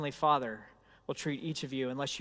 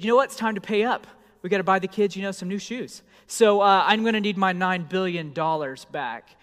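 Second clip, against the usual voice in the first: brisk, louder demand for repayment; pushy creditor; 4 semitones higher, 9 dB louder than usual. Pretend. Creditor demanding his money back, brisk and loud. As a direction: rattle it off like a lender calling in a debt. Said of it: "and you said".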